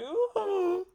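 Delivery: a high, squealed swoon of delight; swooning ooh. Retold or imitated. Comic reliving his childhood crush, squealing ooh up high. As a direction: squeal it like a lovestruck kid.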